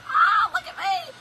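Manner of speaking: high, slow, gloating shout; gleeful victory dance shout. Retold or imitated. Dancing in front of her husband, shouting gleefully. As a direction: show off your win. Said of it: "y'all was dancing".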